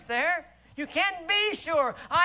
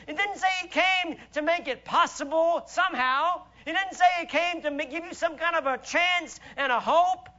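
usual speaking voice

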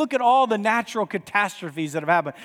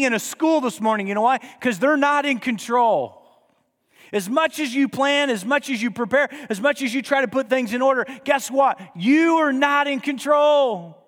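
preaching building up; 5 semitones higher than usual. Real, not acted. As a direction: build the crowd to the peak. Builds preaching at full force.

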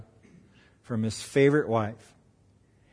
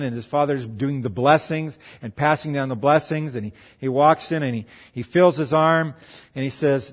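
ordinary delivery

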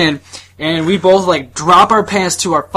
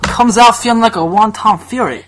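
raised, hammed-up Japanese accent; mock Japanese accent; 5 semitones higher than usual. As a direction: keep up the fake Japanese accent. Continues the mock accent riff.

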